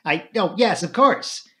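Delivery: flustered high yes. Acted. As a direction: caught off guard, stammering. Flora caught off guard, flustered.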